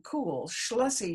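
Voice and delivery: usual speaking voice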